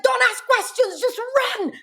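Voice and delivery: very high, urgent squeal; mock panic breaking into laughter; retold frantic order. Big sister ordering her sister to flee, high mock panic. Retold fleeing from supposed gunshots.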